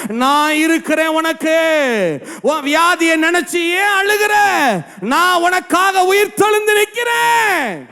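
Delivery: climbing, drawn-out cry voicing God to the flock; impassioned revival preacher, stretching line endings; fervent preaching. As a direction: build steadily to a high-pitched peak. Preaching at full force.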